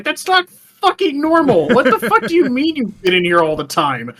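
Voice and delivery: disbelieving rant at her; exasperated. Retold or imitated. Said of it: "I'm like".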